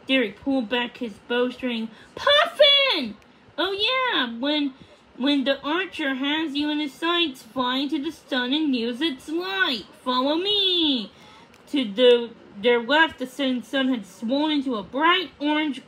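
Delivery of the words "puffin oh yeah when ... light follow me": high, loud shout then urgent plan; excited call. A narrator's reading. Puffin shouting the escape plan to Odette, excited. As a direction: call out and lead her.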